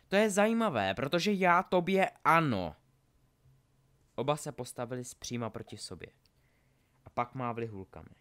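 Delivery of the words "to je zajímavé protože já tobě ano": loud, raised duelling threat; menacing retort. Acted. Draco answers Harry before they duel, menacing retort. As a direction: throw the threat back, high and loud.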